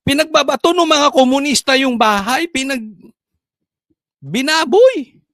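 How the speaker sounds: high, loud outraged rant; indignant rant. Real, not acted. Vlogger railing against communists, high and loud. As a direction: let the outrage spill over.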